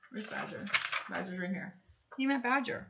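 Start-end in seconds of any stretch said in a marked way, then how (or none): 0.1-1.7 whisper. Acted. Teacher searching, story time.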